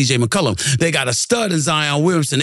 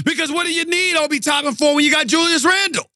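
high-pitched, exasperated hollering; incredulous sports-host tirade; indignant rant; 12 semitones higher than usual. Real, not acted. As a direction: throw their excuse back at them, voice way up. Angry rebuttal to the Knicks' front office.

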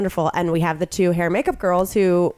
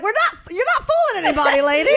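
high, laughing tease; laughing retort; 10 semitones higher, faster than usual. Real, not acted. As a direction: tease her through laughter. Teasing the humming receptionist.

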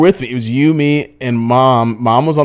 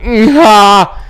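higher, louder strained groan and pant; hammed-up cat groan; 7 semitones higher, 6 dB louder than usual. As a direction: groan like a cat mid-labour.

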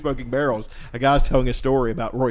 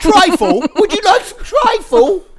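very high, loud British squawk; hammed-up English hostess, drawn out; mock British accent; 8 dB louder, much slower than usual. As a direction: offer dessert in a screechy fake British voice.

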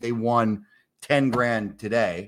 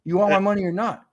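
much higher, pushy and defiant; cocky challenge; 10 semitones higher than usual. Retold or imitated. [name] dares the bookie to refuse his money, cocky. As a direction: call his bluff. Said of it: "I said".

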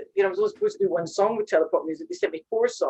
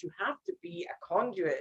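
whispered aside; breathy confiding whisper; 7 dB quieter than usual. Real, not acted.

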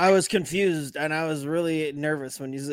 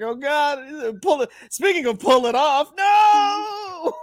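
crying, worn out; 8 semitones higher, slower than usual. Retold or imitated. Panicked retold reaction, cry.

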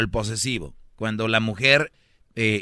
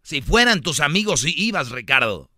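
put-on high, loud, accusing girlfriend; jealous girlfriend voice; 5 semitones higher, 6 dB louder than usual. Possessive girlfriend guilt-tripping her boyfriend Ricardo, high and loud. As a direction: snap it at him like a jealous girlfriend. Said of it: "Y cambia la voz así".

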